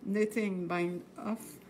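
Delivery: slow English words with a French accent. Shown as a demonstration. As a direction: say "knitting bind off" carefully in English, with a French accent. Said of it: "excusez mon accent". («knitting bind off» dit lentement en anglais, avec un accent québécois)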